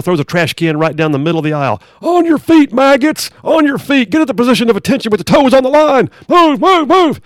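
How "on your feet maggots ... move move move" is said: high, loud barking drill instructor; drill sergeant. Acted. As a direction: scream orders at the recruits. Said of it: "The drill sergeant comes busting in".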